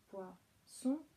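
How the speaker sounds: POISSON slowly, pois then son, rising at the end; split syllables. Shown as a demonstration. Teacher modelling the word for parents, poisson slowly split in syllables. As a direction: break poisson slowly in two. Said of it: "répéter ce qu'ils viennent de dire en dernier".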